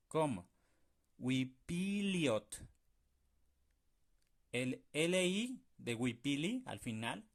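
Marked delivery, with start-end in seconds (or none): 1.2-2.6 "Huipiliot" muy lento, li y ot fundidos en una sílaba; sílabas unidas. Shown as a demonstration. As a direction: une li con ot sin pausa, despacio. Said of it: "como si fuera una sola sílaba". ("Huipiliot" de corrido, lento)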